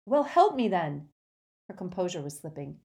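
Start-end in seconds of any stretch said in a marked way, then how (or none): 0.1-1.1 loud, higher, exasperated; urgent raised-voice retort; heated demand. Acted. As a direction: snap back a loud demand. Harry argues with the ghost.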